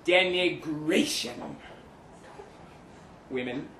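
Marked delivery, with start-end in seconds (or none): 0.0-1.6 announcing the rule. Acted. Declaimed seduction rule heading.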